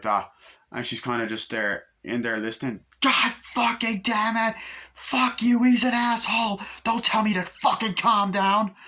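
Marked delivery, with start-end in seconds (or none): 3.0-8.7 imitating a furious scream, much higher and louder; mother cursing down the phone, hammed-up shouting; mock screaming fit. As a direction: explode at the person calming you down.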